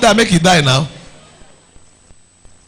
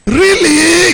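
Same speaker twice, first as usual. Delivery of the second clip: mock surprise; 7 semitones higher than usual. Girl mimicked reacting to compliments.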